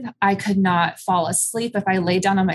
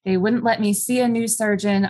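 usual speaking voice

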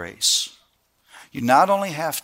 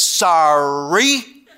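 drawn-out sing-song sorry, rising at the end; insincere apology; 2 semitones higher, 5 dB louder than usual. Retold or imitated. Brother forced to apologize to his sister, mock-grudging. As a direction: stretch it out with a mocking upward lilt. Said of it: "He doesn't".

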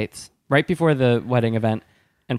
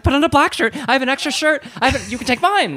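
high, eager helpful voice, much higher; high and bright, eager to help; eager offer; 3 dB louder, faster than usual. Retold or imitated. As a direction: rush to offer the shirt, way up high. Quoting herself offering shirt.

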